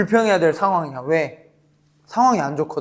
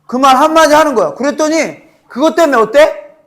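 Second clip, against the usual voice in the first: ordinary talk, nothing marked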